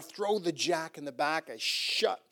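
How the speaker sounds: usual speaking voice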